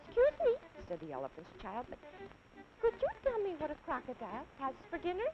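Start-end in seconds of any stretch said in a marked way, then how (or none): 0.1-0.5 put-on very high, small child voice; elephant's child. Little elephant politely asking the snake, high child voice. 1.9-5.3 put-on very high, small child voice; elephant's child. Little elephant politely asking the snake, high child voice.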